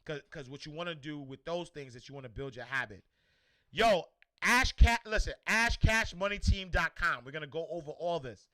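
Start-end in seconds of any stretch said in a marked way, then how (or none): 3.7-7.2 loud, slow, hyped-up shout of the website; pumped promo call-out; hype plug. Acted. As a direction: sell it to the crowd like an announcer. Hyping his website to viewers.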